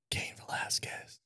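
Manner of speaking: whispered name reveal. Acted. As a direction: breathe the name secretly. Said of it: "whispered reveal".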